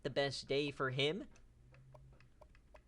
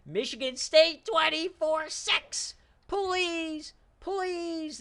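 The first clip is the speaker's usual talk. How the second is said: excited pleading; 12 semitones higher, 8 dB louder, slower than usual. Real, not acted. Begging team to finish the game.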